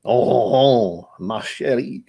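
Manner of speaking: hammed-up French accent like Pepe Le Pew; cartoon suitor with a French accent; horrible French accent. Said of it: "I can do a horrible French accent too".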